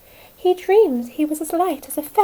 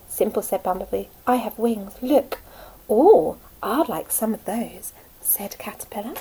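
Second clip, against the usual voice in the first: usual speaking voice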